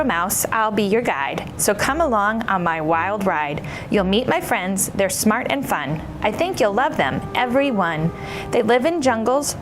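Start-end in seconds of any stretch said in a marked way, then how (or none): none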